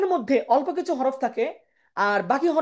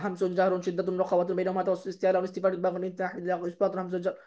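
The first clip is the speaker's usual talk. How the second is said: rapid one-breath list, softer; one-breath recitation; 4 semitones lower, 6 dB quieter than usual. Shown as a demonstration. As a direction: rattle off all terms in one breath, quietly. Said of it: "একদমে চার পাঁচ বার".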